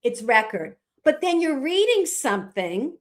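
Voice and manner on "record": record as REK-erd, short e, stressed first; noun pronunciation. Shown as a demonstration. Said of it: "when you say it quickly together".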